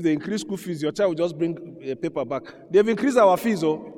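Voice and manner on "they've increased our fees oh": put-on high, loud child's whine; child's complaint. Preacher voices a child reporting fee increase, whiny and loud. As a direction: whine it like a schoolchild bringing bad news.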